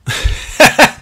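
short breathy nervous laugh. Real, not acted. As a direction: laugh it off nervously, high and airy. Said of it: "I, I'm laughing. It's sort of a nervous laughter".

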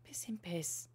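whispered swearing under the breath; whispered curse. Real, not acted. To herself over the lost leaflet, whispering. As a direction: hiss the curse out unvoiced.

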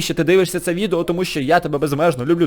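ordinary delivery

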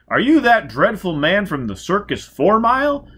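high and loud, scandalised delight; shrill society matron. Acted. Society lady confronting the circus man, high and loud. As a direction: play the gushing grande dame.

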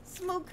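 "Smoog" with OU said as oo; "Smoog" the mock-French way, long oo; French-style pronunciation. Shown as a demonstration. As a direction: say "Smoog" with an oo vowel and silent H. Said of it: "O U is pronounced".

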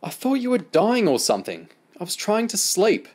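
high, loud exasperated complaint; cross, high-pitched grumbling; annoyed scolding. A narrator's reading. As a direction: scold them for waking you, pitch way up. Woken woman scolds the children.

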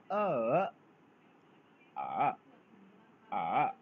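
आ dipping low then rising; falling-rising tone on आ; Chinese third tone. Shown as a demonstration. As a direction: dip आ down to the bottom, then lift. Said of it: "ए की pronunciation हो जाएगी".

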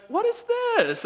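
high squealing what is this; surprised squeal. Retold or imitated. Wife finding her hidden present, squealing in surprise. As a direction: squeal like she just found the gift.

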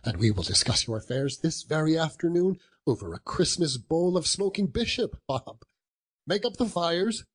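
high, excited stream of friendly orders; eager enthusiasm. A narrator's reading. Reformed boss sending his clerk for coal, eager. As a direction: rattle off the plans, rising with excitement.

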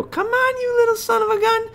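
very high, coaxing squeal; high puppy-calling voice. Shown as a demonstration. Trainer calling the dog after counting, high voice. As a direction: hide the frustration in a high sweet call.

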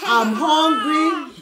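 a lightly voiced, slow baby plea; slow baby whine. Mother speaking for her baby, a slow mild whine. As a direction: drag it out like a hungry infant. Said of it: "That shows that".

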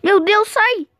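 high panicked cry to get away. Real, not acted. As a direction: yell in a panic. Panicked yelling at zombie.

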